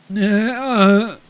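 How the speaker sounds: high, drawn-out moan of agony; pained moan. Acted. Dying person on the road answers, moaning in pain. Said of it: "moaned through a mouthful of blood".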